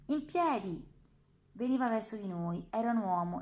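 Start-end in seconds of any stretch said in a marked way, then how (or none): 0.1-0.9 a loud order that echoes across the courtyard; booming command. A narrator's reading. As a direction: bark it out high and loud to the whole yard. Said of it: "una voce rimbombò nel cortile". (ordine urlato che rimbomba nel cortile)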